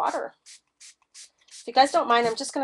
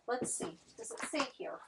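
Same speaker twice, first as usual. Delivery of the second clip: unvoiced aside while searching; whispered to herself; 4 semitones higher, 11 dB quieter than usual. Real, not acted. Checking the board label, whispering to herself. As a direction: breathe it as you read the label.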